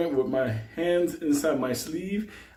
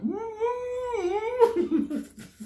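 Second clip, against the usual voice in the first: muffled cry; 3 dB quieter than usual. Retold or imitated. Gagged guy tries to answer.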